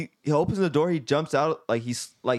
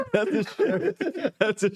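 laughing squeak; 6 semitones higher, slower than usual. Real, not acted. Repeats line in squeaky laugh.

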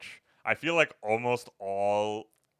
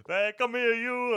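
De Niro stomping a guy in the film; Robert De Niro impression; 13 semitones higher, slower than usual. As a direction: call him over like a menacing mobster. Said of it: "the classic Robert De Niro impression".

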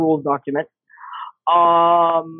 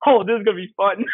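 laughing; 7 semitones higher than usual. Retold or imitated. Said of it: "And I was like".

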